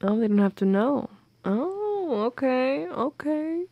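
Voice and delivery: ordinary talk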